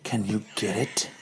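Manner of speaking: seduce him in a hushed low voice; seductive Madam Hydra. Acted. Madam Hydra flirts with Hank in a low purr. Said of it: "Madam Hydra knocks a pen".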